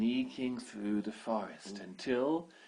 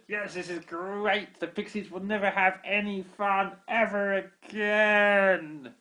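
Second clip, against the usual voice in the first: high, loud, slow gloating; gleeful goblin; 9 semitones higher, 10 dB louder, slower than usual. Acted. Baby goblin gloats over the ruined pixie plans, slow high glee. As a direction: savour every spoiled plan. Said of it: "the baby goblin said".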